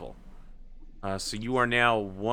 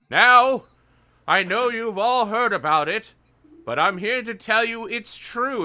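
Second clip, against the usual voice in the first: high, raised elder rabbit proclaiming; high-pitched elder rabbit voice; old rabbit elder; 11 semitones higher, 6 dB louder than usual. Acted. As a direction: announce it like the wise old leader. Old rabbit addresses the warren.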